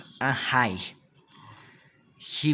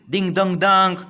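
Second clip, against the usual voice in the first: sung-out ding dong, higher and louder; imitating a bell; 6 semitones higher, 6 dB louder than usual. A narrator's reading. Boy playing riverboat calls out like a bell. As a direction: ring it out like a boy's toy bell. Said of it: "like a bell".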